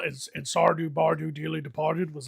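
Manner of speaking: usual speaking voice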